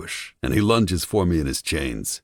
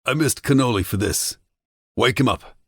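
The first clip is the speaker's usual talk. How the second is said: higher, annoyed command; a touch of bored menace; irritated mob boss order; 9 semitones higher, 3 dB louder than usual. A narrator's reading.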